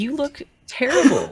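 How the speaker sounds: high, loud blunt remark; blunt friend. Acted. Guest to exhausted mother at the door, high blunt blurt.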